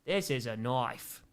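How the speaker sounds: put-on slow second try at an Australian accent; a modest Australian accent attempt. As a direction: repeat the knife line in Australian. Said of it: "That's the best I got".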